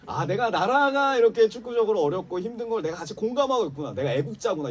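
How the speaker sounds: high, loud rush of mock revelation; acted self-realization. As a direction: exclaim it as a grand discovery. Acting out his proud self-realization.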